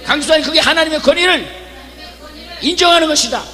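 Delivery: loud, high preaching shout; 강하게 외치는 설교; full-force preaching. Acted. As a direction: preach it with fire.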